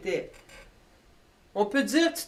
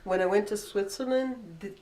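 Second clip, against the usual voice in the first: normal talking voice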